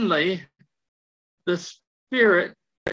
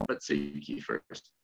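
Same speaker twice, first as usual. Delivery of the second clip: quiet voice; 11 dB quieter than usual. Retold or imitated. God's quiet reply again.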